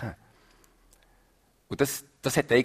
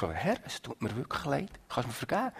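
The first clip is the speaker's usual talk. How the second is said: much softer, penitent plea to God; 11 dB quieter than usual. Acted. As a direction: quietly ask the Lord for forgiveness. Acted plea to God for forgiveness. (leise, reumütige Bitte)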